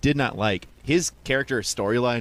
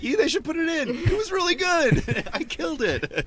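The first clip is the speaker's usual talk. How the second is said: much higher, slow, gushing put-on voice; mock proud actor. Podcaster mocks the actor hoping his scene gets in, much higher gushing voice. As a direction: ham up a proud actor bragging. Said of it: "It's like".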